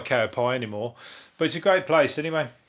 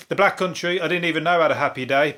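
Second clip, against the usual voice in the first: ordinary delivery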